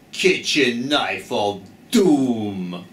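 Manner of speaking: slow, low doom voice; ominous voice. Pretend. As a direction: say it like a horror trailer. Ominous naming.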